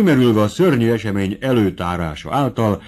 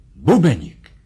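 mild dramatic exclamation; vibrating outcry; 4 dB louder, slower than usual. A narrator's reading. Captain's wife alerting the servant, vibrating cry. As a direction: cry the name out with quivering urgency. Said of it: "Kiáltott fel vibráló hangon".

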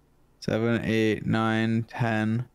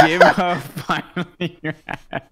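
laughing while slowly rereading the line; mock reading through laughter; 5 semitones higher, 5 dB louder, slower than usual. Shown as a demonstration. Reading out the friend's haiku again, laughing mockingly.

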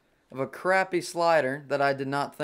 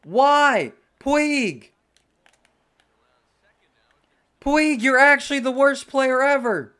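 higher, louder real yell at Puig; angry yell; 9 semitones higher, 8 dB louder, slower than usual. Real, not acted. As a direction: shout your fury at the teammate who lost it. Yelling at teammate after loss.

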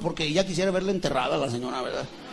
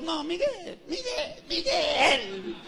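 very high, softer wailed calling; Josefa interrupting; 9 semitones higher, 5 dB quieter, much slower than usual. Acted. Josefa stopping Miguel's speech, high plaintive calling. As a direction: call his name in a high, stretched voice.

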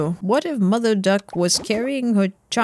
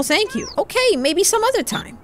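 put-on high-pitched child voice; little kid; 10 semitones higher than usual. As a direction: play a small kid accepting a no. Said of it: "let's talk to the kids".

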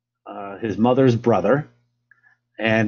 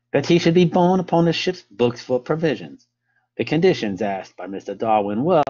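hammed-up amateur English accent, lilting. Lecturer reading Fitzroy's account to listeners, in an English accent. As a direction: read it out in your best English accent. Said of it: "best English accent".

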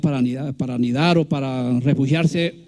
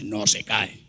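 low, softer mock male bird voice; deep male reply; 9 semitones lower, 5 dB quieter, slower than usual. Lecturer mimicking the male guacharaca answering, deep and low. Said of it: "Él le responde en un tono grave".

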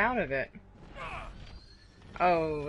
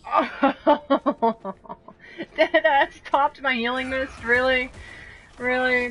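laughing disbelief; 6 semitones higher, 5 dB louder, slower than usual. Real, not acted. Healing mist suddenly cancelled.

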